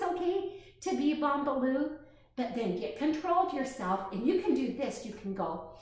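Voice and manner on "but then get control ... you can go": usual speaking voice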